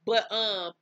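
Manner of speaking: normal talking voice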